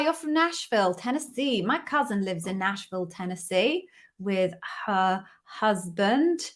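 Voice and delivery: ordinary talk, nothing marked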